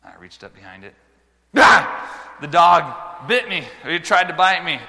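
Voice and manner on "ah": high loud startled yelp; retold yelp of shock. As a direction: cry out as the dog bites. Reenacting being bitten.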